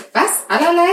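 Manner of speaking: hohe, laute ungläubige Frage; verblüfft nachhaken; überrascht. Real, not acted. Ungläubige Rückfrage. (überrascht)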